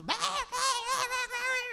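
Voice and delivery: squealed high, dragged-out mimicry of wild kids; frantic mock chaos. Pastor mimicking the screaming choir kids, shrill and slow. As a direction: squeal like a mob of overexcited kids.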